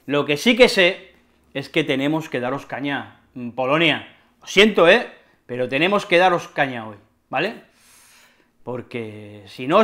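ordinary talk, nothing marked

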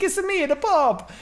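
put-on slow, high Italian accent; Italian Pope accent. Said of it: "this big Italian accent".

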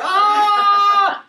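very loud, high mock shriek; all-out imitation of a woman screaming. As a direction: scream at full blast, overcome. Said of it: "oh, she screamed even louder".

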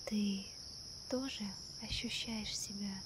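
ordinary talk

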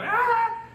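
very high shrieked cry; mock hysterical shriek. Pastor imitating the sister's hysterical cry, high shriek. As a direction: let out a sudden high wail.

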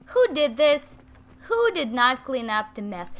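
loud mock-stern demand, words punched; mock scolding. Playing the stern parent who found the mess, loud mock scolding.

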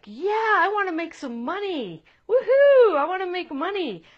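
put-on high, loud kid cheering woohoo; a kid whooping over the money; excited kid. Kid number two cheering at the offer, excited. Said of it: "It's like".

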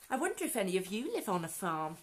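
ordinary delivery, nothing marked

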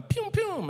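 high pew pew gun sounds; kid-style gunshot noises; mock gunshots. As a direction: fire off a toy gun noise. Imitating gunshots.

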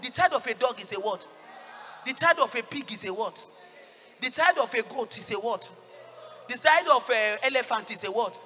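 loud, punched preaching questions; loud call-and-response drill. Real, not acted. Preacher drilling congregation on whose child is what, loud prompts. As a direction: call out each question for the crowd.

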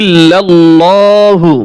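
ইল্লাল্লাহু recited slowly, vowels lengthened; correct recitation. Shown as a demonstration. Teacher correcting a common mistake, slow careful recitation. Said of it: "শব্দটা কি?".

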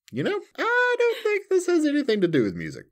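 high, fast mock realization; realizing voice. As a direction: squeak it out in sudden realization. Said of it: "and then I'm like".

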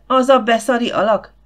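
loud, high yell of contempt; howling. A narrator's reading. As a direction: hurl the insult at full voice. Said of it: "sűvöltötte Gifford".

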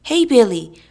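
loud, high nervous outburst; loud, uneasy hail; hearty, quivering. A narrator's reading. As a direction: burst out with forced heartiness. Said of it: "burst out Tom suddenly in a hearty, quivering voice".

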